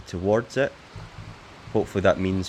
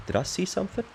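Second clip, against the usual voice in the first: sudden high surprised question; 12 semitones higher, 4 dB quieter, faster than usual. Real, not acted. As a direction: catch sight of something. Spots the island, surprised.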